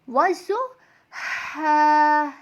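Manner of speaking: "वज़्ज़ु" soft on the ज़, then "हा" held long; soft letters, demonstrated. Shown as a demonstration. Teacher shows how the verse is read, "हा" soft and drawn out. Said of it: "ऐसे".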